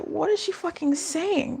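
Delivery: high, slow, hushed baffled aside; whisper-ish puzzled inner voice; mock confusion. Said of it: "and I was like".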